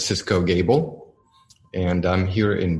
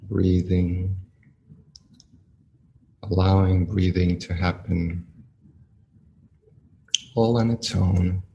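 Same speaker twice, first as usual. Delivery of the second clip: guided meditation. Acted. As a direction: ease the listeners into stillness, one phrase at a time.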